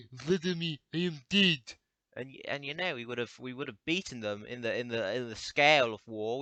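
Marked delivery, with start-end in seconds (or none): 0.1-1.8 dying Russian soldier, raised and loud; hammed-up Russian death cry; mock Russian soldier. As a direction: call out in a comic Russian accent. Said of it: "let's say we're, like, fighting Russia or something".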